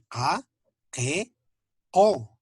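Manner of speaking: "K, K, O" with the k made far back in the throat; tongue root back for each sound in "K, K, O"; postvelar Q. Shown as a demonstration. Teacher demonstrating the Aymara Q, postvelar stop. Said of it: "La pronunciación es".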